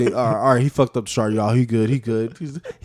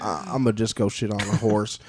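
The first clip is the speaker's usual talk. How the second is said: Texas pigeon; 5 semitones lower, 3 dB quieter than usual. Acted. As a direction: say it like an unbothered Texan bird. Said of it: "And the Texas pigeon's like".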